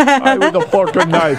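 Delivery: laughing try at a New York accent on "fork and knife". Shown as a demonstration. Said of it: "in that New York accent".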